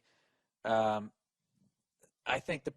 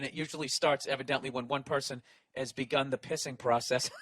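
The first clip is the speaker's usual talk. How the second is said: ordinary talk, nothing marked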